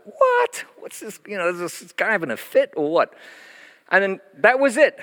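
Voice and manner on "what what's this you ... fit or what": shocked onlookers; startled nurses. Retold or imitated. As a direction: play the alarmed nurse who thinks he's having a fit. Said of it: "the nursing sisters were like".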